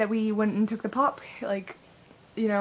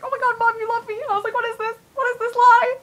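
very high, gushing retold disbelief; excited; 13 semitones higher, 6 dB louder than usual. Kid amazed that mom loves them, excited. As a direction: act out being overjoyed, pitched way up. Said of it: "I was so excited".

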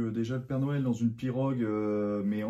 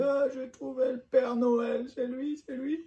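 man doing a woman's voice; slower than usual. Retold or imitated. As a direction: mimic the mother, high and excited. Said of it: "t'es en train d'imiter ma mère?".

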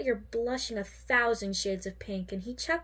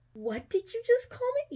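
high, startled disbelief; shocked and offended, pitch jumps up; 13 semitones higher than usual. A narrator's reading. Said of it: "you said in shock".

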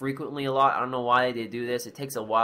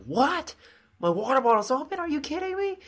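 high-pitched incredulous questions, rising at the end; acted disbelief; 13 semitones higher than usual. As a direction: replay your shock, pitch shooting way up. Acts own shocked reaction.

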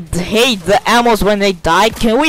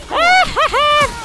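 very high, gleeful cackle; triumphant laugh. Real, not acted. Gamer gloating after scoring, high triumphant laugh. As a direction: squeal with laughter at the win.

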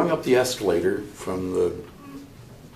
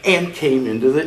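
ordinary talk

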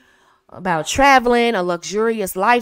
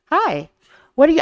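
higher, smiling greeting; speaking with a smile; 6 semitones higher than usual. Shown as a demonstration. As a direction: greet him with a smile in your voice. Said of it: "when I started speaking with a smile".

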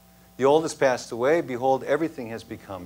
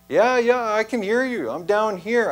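higher and louder, eager to be heard; eager relieved answer; 8 semitones higher, 4 dB louder than usual. Acted. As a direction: shout up, desperate and relieved. Jack answering God from cliff.

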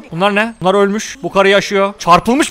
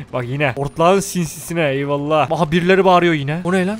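normal talking voice

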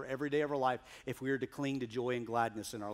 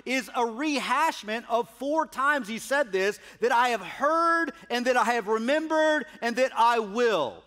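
raised, ringing pulpit voice reading scripture; preaching; 7 semitones higher, 7 dB louder than usual. Acted. Preacher proclaiming God's words to the congregation, fervent preaching.

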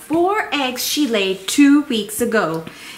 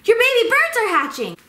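lightly voiced hummingbird, higher and quick; a chirpy little visitor rushing in; a hint of a tiny bird, pitched up; Missus Hummingbird; 11 semitones higher, 3 dB louder, faster than usual. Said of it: "What did Missus Blue want to tell me?".

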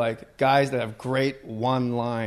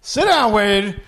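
higher, louder mock sideline rant; mock coach yell; 7 semitones higher, 3 dB louder, slower than usual. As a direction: bark at the player like a furious coach. Mock coach yelling at player.

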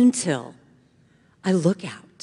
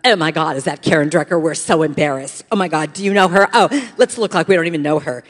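loud, mocking Valley Girl; 2 semitones lower, 8 dB louder, faster than usual. Cool girls spotting a schoolmate on stage, mocking Valley Girl. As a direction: put on a catty Valley Girl voice. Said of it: "doing that Valley Girl thing".

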